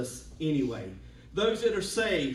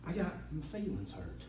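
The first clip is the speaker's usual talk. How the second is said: sudden soft drop; 10 dB quieter than usual. Acted. Drop after buildup.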